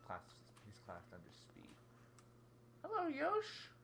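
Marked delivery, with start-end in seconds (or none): 2.9-3.7 very high, loud squeaky hello; cutesy greeting. Pretend. Player greeting Yoshi, high cutesy voice. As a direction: squeal hello to a cartoon pal.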